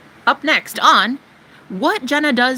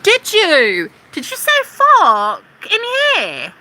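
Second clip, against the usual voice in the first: mocking prissy troll; 8 semitones higher than usual. Mimicking a troll who scolds her swearing, high and whiny. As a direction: sneer it like a scandalised prude.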